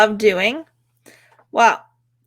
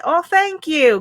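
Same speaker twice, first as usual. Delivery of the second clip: high, gushing thanks; acted delight; 7 semitones higher, slower than usual. Passer-by thanking someone for a compliment, high acted delight. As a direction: play a flattered stranger, pitched up.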